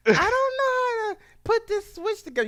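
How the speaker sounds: put-on very high-pitched kid voice; mimicked child. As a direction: do a helpless little kid, high up. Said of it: "your kid be like".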